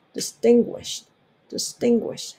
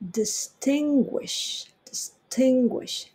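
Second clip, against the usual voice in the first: Distinguish with tin stressed, slow and higher; 5 semitones higher, much slower than usual. Shown as a demonstration. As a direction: hit the second syllable of distinguish. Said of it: "アクセントストレスがten". (distinguishのtinを強く)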